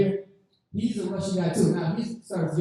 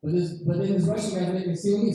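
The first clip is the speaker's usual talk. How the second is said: ordinary talk, nothing marked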